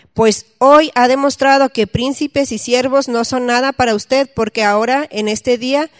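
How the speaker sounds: loud, formal Bible reading to the congregation; scripture reading. Acted. Preacher reads King David's story aloud, loud and solemn. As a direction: proclaim the passage from the pulpit.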